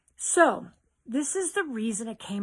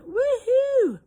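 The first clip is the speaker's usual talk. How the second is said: high, loud whoop of delight; excited cheer; 14 semitones higher, 10 dB louder than usual. Real, not acted. Host cheering Susan into the live, a high loud whoop. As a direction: cheer as a friend joins the live.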